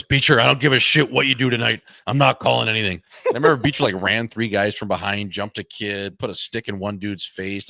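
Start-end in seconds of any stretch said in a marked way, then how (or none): 0.0-3.0 loud, dismissive referee; mock loud referee brushing off a player; careless ref. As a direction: tell him flat out you won't call a thing. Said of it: "he goes".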